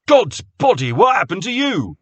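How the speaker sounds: high, loud cry of shock; shocked alarm. A narrator's reading. Jake alarmed at bloodied Carter, shocked cry. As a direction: gasp it out at the blood.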